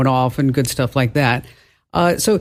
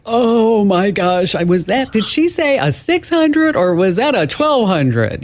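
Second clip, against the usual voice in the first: higher, slow, hammed-up confused listener; mock flustered listener; 7 semitones higher, slower than usual. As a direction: act out a caller scrambling to catch the numbers. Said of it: "you're gonna be like".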